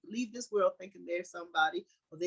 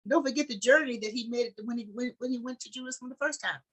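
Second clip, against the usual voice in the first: raised, louder preaching build; stirring sermon climax; fervent preaching; 5 semitones higher, 5 dB louder than usual. Acted. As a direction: preach it up to the congregation. Preacher building to full force.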